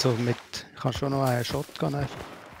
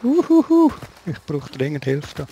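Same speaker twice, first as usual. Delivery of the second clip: high, loud cry for help; panicked call for help; 3 semitones higher, 6 dB louder than usual. Real, not acted. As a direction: yelp for help under fire. Calling for help under fire. (panischer Hilferuf)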